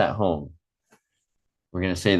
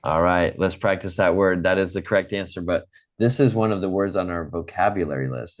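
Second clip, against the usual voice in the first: ordinary delivery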